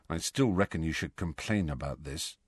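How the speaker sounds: a soft murmur, touch of grumble; low voice. Acted. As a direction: keep it down, half confiding. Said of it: "said Ron in a low voice".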